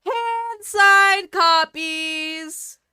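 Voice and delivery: very slow, high, loud stretch; drawn out. Real, not acted. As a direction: stretch it out dreamily. Drawn out longingly.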